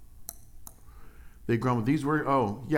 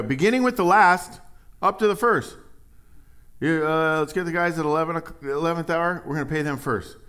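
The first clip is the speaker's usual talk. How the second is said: raised, louder boss voice; the master giving orders; 8 semitones higher, 7 dB louder than usual. Acted. Vineyard owner ordering the foreman, loud and high. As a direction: call out the pay order like a foreman.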